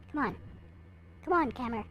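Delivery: usual speaking voice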